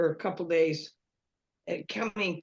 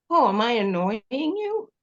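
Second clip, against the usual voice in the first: sarcastic jab; 3 dB louder than usual. Retold or imitated. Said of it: "I did it kinda sarcastically".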